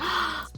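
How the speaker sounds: soft acted gasp; mock shocked gasp. Hearing he will skip school tomorrow, mock gasp. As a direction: suck in a quick breath. Retold reaction to his news.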